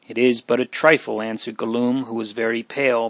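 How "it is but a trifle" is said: ordinary delivery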